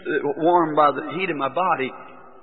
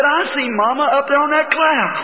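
put-on child's wonder; 10 semitones higher, 3 dB louder than usual. Voicing his small son.

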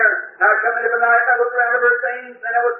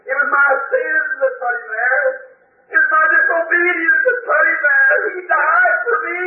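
crying out; 7 semitones higher than usual. Real, not acted. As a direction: cry it out in grief. Breaks down preaching the cross.

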